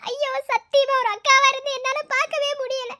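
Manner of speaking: crying out in distress, voice breaking; tearful cry. Acted. Sister crying to husband about her suffering brother, tearful.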